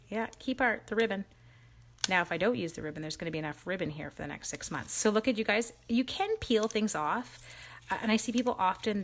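ordinary talk

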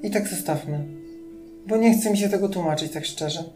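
ordinary delivery

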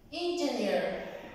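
"engineer" said slowly and clearly; slow English demo. Shown as a demonstration. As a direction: model "engineer" slowly for the class. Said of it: "Ingeniero en inglés se dice".

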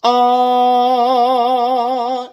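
loud sustained chest-voice "Ah". Shown as a demonstration. Coach demonstrating the same note, chest version. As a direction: hold "Ah" in full chest voice. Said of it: "In the chest".

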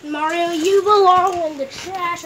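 loud mocking shout; loud taunt. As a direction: yell the insult at the toy. Taunting toy Mario loudly.

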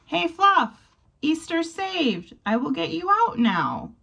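calling out. Acted. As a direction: call across to Fluff. Calling to trapped Fluff.

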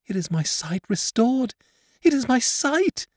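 put-on high, loud girl's outcry of joy; a blind girl suddenly understanding; joyful cry. A narrator's reading. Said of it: "she cried".

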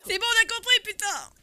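high, loud exasperated snap; exasperated outburst. Real, not acted. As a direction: cut him off, fed up. Exasperated at the beeping.

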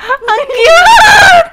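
very high, shouted squeal; delighted squeal. Real, not acted. Player gushing over something cute, high squeal. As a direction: squeal it out at the cuteness.

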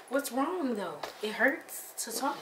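normal talking voice

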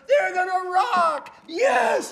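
high, drawn-out rock-show holler; hammed-up announcer shout; hype-man emcee. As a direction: whip the arena up like a radio DJ. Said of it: "He goes".

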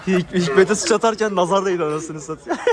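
loud, chuckling all through; laughing. Real, not acted. To friends about Hikmet's broken glass, laughing. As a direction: tell it while laughing.

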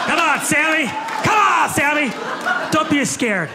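high, loud coaxing shout; comic calling out to shy audience member Sam, loud. Real, not acted. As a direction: egg Sammy on loudly.

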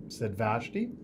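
usual speaking voice

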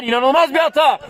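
screaming football commentator. Acted. Goal call in match commentary.

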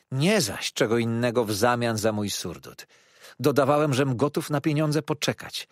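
usual speaking voice

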